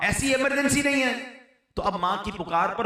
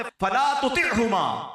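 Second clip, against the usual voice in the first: Quran recitation; 4 semitones higher than usual. Acted. Quoting the Quran in a sermon.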